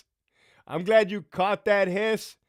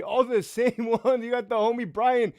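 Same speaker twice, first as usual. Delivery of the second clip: ordinary talk, nothing marked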